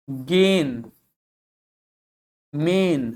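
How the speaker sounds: गेन and मेन as "gain, main", vowels held; isolated words, long "ay" in गेन and मेन; long A sound. Shown as a demonstration. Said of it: "ए long sound है".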